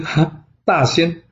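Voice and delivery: drawn-out amused scoff; amused disbelief. Acted. Young prince laughing off a flattering title, slow and amused.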